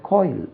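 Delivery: "காயில்" as "koyl", with oy; correct rounded vowel. Shown as a demonstration. Teacher giving the correction, "காயில்" said as "koyl". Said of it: "காயில் இல்ல".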